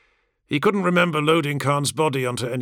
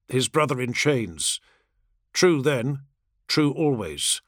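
usual speaking voice

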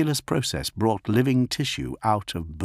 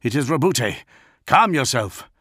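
raised, urgent plea; pleading; 6 semitones higher than usual. Acted. Gilliman pleading with his maddened brother, urgent. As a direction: plead to be recognised.

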